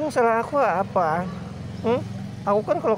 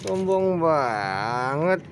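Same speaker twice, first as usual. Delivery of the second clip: lower, very slow drawn-out remark; slow mock sulk; 3 semitones lower, much slower than usual. Real, not acted. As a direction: stretch each word out lazily, lower down. Teasing the cat again.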